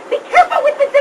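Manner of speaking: mock-warning like a fussy mother; imitating Mom. Speaker quotes Mom warning the kids about the Hummel, high fussy voice. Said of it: "'cause Mom would say".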